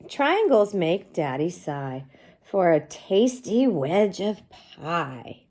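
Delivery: put-on sing-song read-aloud for young kids; reading to children. Teacher reading the book aloud to her class, slow and bright.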